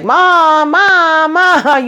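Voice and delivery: high whining Mom, Mom, Mom; whiny daughter. Retold or imitated. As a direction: nag your mother like a needy kid. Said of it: "Karen over here like".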